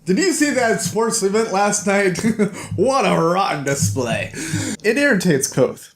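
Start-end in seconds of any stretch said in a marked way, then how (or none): 0.0-4.3 higher, brash mock jock voice with a laugh. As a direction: lightly ham up the noisy sports guys. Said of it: "those other assholes are like".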